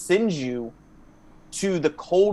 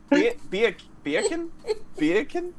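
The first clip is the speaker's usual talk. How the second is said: much higher, squeaky questioning bacon with a whimper; mock confused bacon; 10 semitones higher, slower than usual. Castmate mocks partner's bacon, high and whimpering. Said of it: "how he said it".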